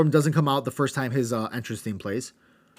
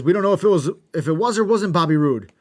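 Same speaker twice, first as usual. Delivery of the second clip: higher, louder retelling of a commentator; hammed-up announcer call; mock commentator shout; 5 semitones higher, 7 dB louder than usual. Host retelling wrestling commentary, mock loud shout. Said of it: "Taz says".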